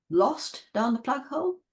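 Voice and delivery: "Lost down the plug hole?" rising high at the end; question intonation. Retold or imitated. Parent gently correcting child, asking back with rising tune. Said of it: "with question intonation".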